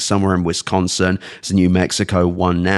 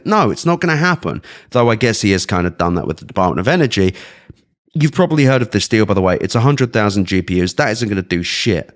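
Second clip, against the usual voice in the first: ordinary talk, nothing marked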